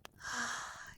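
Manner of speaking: soft, contented exhale; relieved sigh. Acted. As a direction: sigh as the tension melts. Queen relaxing under massage.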